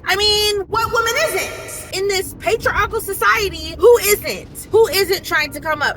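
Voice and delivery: high, loud rhetorical challenge; exasperated, high-pitched clapback; indignant retort. Retold or imitated. Retells her retort to the man.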